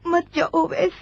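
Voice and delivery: tearful plea. Acted. Sister begs departing Owais not to go, tearful plea. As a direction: voice catching on a sob as she begs.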